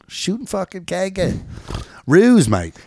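high, slow Aussie drawl with "kanga" and "mate"; Australian accent. Pretend. Host doing a mock Australian for his co-hosts. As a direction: lay on a thick Australian accent. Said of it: "That's my Bondi, uh, accent".

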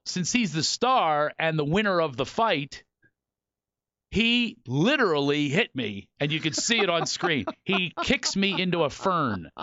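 ordinary talk, nothing marked

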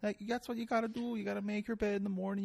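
high, put-on lecturing voice, mocking; mocking Jordan Peterson. Host mocking a self-help professor to his guest, high put-on lecturing voice. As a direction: lampoon a preachy professor, pitched high. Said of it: "like, some Canadian guy".

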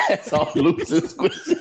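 high, laugh-broken words. Real, not acted. Joking with a friend about the exam, laughing hard. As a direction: crack up while saying it. Both cracking up at story.

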